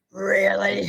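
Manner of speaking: mock grouchy one-word reply. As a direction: grumble it like an unimpressed grouch. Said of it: "in a very grouchy voice".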